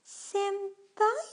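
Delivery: high, loud, drawn-out questioning "Senpai?"; Sakura. Retold or imitated. Vlogger imitates how Sakura from Fate pronounced Senpai, high-pitched impression. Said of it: "as Sakura pronounced it".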